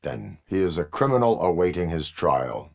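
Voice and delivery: ordinary talk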